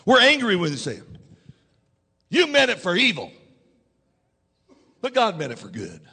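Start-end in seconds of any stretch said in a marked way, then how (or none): 2.3-6.0 sneering retort that fades away; mocking, almost attacking. Shown as a demonstration. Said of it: "We're almost mocking".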